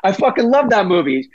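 giddy infielder yelling for Wake; excited Pedroia. Retold or imitated. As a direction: holler for the teammates to come see. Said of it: "every word out of his mouth was fucking".

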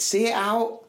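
high-pitched retold touchline call; mild shout from the sideline. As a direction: call it out like instructions to a player. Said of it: "I just shouted".

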